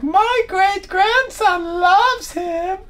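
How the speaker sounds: put-on very high, drawn-out elderly voice; hammed-up ancient audience member; imitated old person. Old cruise passenger answers musician, high elderly voice. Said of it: "eight hundred years old".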